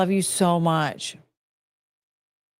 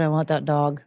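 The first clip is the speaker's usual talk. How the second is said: usual speaking voice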